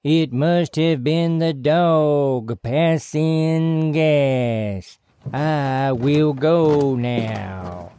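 slow, eerie, possessed voice; possessed monotone. Acted. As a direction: drone it like a hypnotized man. Possessed Bobby Ray leaving.

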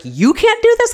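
high, loud incredulous echo; mock incredulous husband. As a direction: mimic his disbelief. Husband questioning her.

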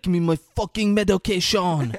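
loud, high imitated yell; mock frantic demand. Imitating a sick man wanting pills, mock frantic demand.

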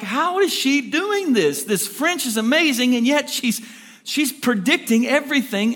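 high-pitched, incredulous wondering; retold disbelief, pitched well up; baffled amazement. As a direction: act out an astonished onlooker puzzling it out. Retold French teacher's baffled thoughts.

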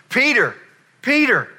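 loud, high shout of a name; high-pitched hollering, falling on each call; urgent call. Acted. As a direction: call to a friend, excited and insistent. Acting John calling urgently to Peter.

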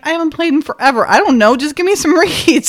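retold exasperated outburst to a salesman; flustered exasperation. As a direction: act out giving up, flustered. Retold flustered exchange with shop salesman.

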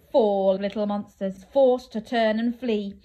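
plain reading voice

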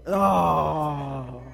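put-on long drawn-out teen groan; mock-mortified "oh" of despair; exasperated groan. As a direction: groan like an embarrassed teenager. Teen's mortified groan at mom.

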